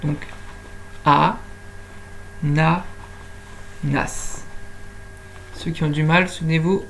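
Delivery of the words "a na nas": "A, na, nas" as three isolated beats; "A, na, nas" slowly separated for counting; counting syllables. Shown as a demonstration. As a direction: leave a long pause after each of "A, na, nas". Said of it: "savoir combien de syllabes il y a".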